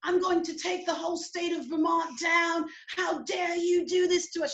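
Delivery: high, outraged British rant; Heidi. Retold or imitated. British girlfriend losing it at the police, high British accent. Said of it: "Heidi's losing it".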